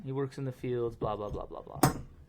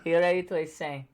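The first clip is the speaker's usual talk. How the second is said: mock instructor; 8 semitones higher, 8 dB louder than usual. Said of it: "mock climbing instructor".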